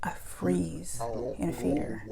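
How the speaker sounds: slow, frozen with fear; frightened whisper. Retold or imitated. A scared child telling of the ghost, frightened whisper. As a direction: barely breathe the words out. Terrified child hiding in bed.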